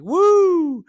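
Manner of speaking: high, loud mock "woo"; long, high-pitched imitated cry of glee; playful imitated whoop. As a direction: whoop like a gleeful, teasing sparring partner. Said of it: "giggling and laughing".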